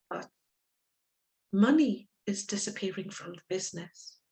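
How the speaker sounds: soft, careful voice, near a whisper in places; gentle, hushed confrontation. Acted. Anne quietly confronts her young employee, hushed and careful. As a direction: broach a hard subject quietly.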